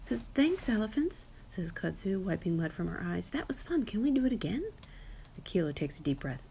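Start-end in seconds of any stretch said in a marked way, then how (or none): 0.1-1.3 put-on high baby gorilla voice; little child's voice. A narrator's reading. 3.3-4.6 put-on high, eager baby gorilla; excited little child. A narrator's reading. Baby gorilla excited after toss.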